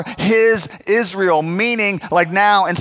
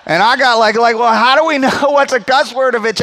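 exasperated protest; 4 semitones higher, 5 dB louder than usual. Retold or imitated. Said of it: "So I'm like".

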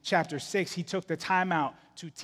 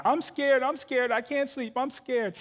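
high-pitched mock little-kid whine; high child voice, lightly panicky; imitated scared kids; 8 semitones higher than usual. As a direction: plead like kids who won't stay in bed. Imitates his kids running out of bed.

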